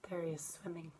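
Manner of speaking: soft whisper pointing out the fish; whispered aside. Real, not acted. Teacher shows the children the fish, whispered. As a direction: hush and point at the picture.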